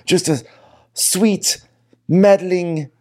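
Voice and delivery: high, slow, sugary mock Lisa; campy Lisa impression. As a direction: coo it out like a meddling rich lady. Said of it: "I love Lisa saying".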